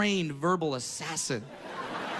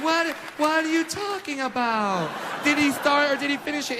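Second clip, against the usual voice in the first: mock confused parent; 6 semitones higher than usual. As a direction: play a clueless modern parent. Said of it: "Some of the younger parents are like".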